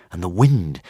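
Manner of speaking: ordinary delivery, nothing marked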